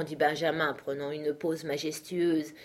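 ordinary delivery, nothing marked

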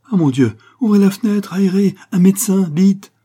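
high, loud cry for help; alarmed cries. A narrator's reading. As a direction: shout out in panic for a doctor. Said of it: "Ces cris firent gémir les échos". (cris affolés, aigus et forts)

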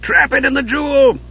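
high, urgent command; commanding shout. Acted. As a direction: bark the order at her. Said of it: "Dugan commanded".

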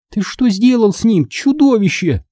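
high-pitched accusing cry; girl's shout. Acted. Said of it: "закричала Минди". (высокий крик возмущённой девочки)

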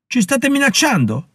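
narrated shout at the accuser, voice jumping high. A narrator's reading. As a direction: fling the question up high in disbelief. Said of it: "gridò Reinhardt".

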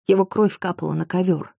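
ordinary delivery, nothing marked

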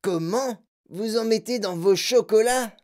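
high, incredulous questioning; Mike crying out. A narrator's reading. Boy questioning the chocolate maker, astonished. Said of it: "cria Mike T V".

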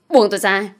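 mild narrated shout. A narrator's reading. Man held back from beating his rival, mildly shouting. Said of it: "Anh quát lên".

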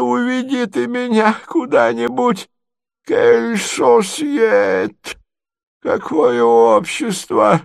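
very high, slow lamenting voice; doddering prince, distraught. Acted. Prince begs nephew to lead him away, high and plaintive.

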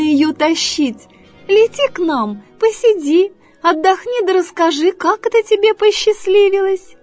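high, honeyed fox flattery; syrupy, high-pitched praise; sweet coaxing fox. Acted. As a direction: coo admiringly to lure her down. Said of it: "ласковым голосом начала она".

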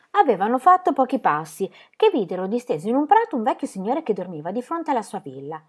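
usual speaking voice

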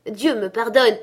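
astonished cry. A narrator's reading. Fred discovers his uncle at the door, astonished cry. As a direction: cry out in surprise. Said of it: "s'écria Fred".

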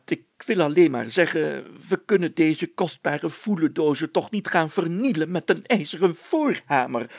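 ordinary delivery

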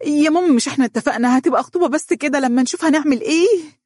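high, rushed protest; hurried. A narrator's reading. Daughter cutting in on her mother's objection, hurried and high. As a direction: jump in fast to head her off. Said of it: "وقالت بسرعة".

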